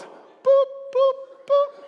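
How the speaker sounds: high imitated beeps. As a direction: mimic the button beeping. Pressing treadmill speed button.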